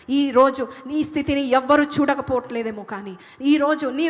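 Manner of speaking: loud, fervent sermon; preaching at full force. Real, not acted. Preacher exhorts the suffering listener, loud and fervent.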